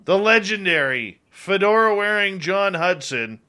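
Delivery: upbeat announcer read over a music bed; radio announcer. Acted. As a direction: hype the next guest like a radio promo. Announces next segment over music.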